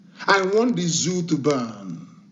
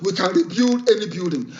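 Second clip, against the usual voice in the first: ordinary delivery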